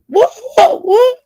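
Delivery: very high squealing what, what, what, breaking into a laugh; shrill what what. Retold or imitated. Aunt squealing back at the man insulting her, very high and laughing.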